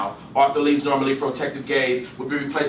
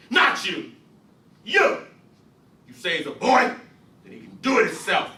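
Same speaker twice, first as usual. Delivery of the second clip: harsh father; 9 semitones higher, 3 dB louder than usual. Acted. Gus orders the child.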